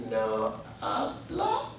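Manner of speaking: slow, low "No habla", rising at the end; hesitant questioning. Retold or imitated. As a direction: say "No habla" haltingly, softly, as a question. Nervously repeats the coached phrase.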